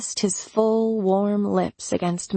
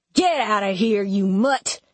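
growled; 2 semitones higher than usual. Acted. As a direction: drive him off with contempt. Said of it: "Duke growled".

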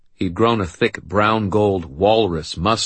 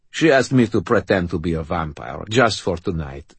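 put-on French-accented explanation. A narrator's reading. Brother explains the vampire game, French accent. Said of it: "said in his French accent".

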